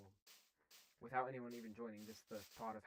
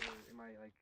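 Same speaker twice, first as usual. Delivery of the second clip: ordinary talk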